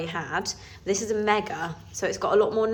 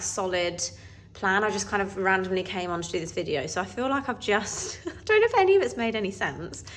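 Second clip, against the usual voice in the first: normal talking voice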